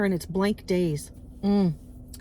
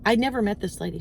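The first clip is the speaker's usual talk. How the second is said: ordinary talk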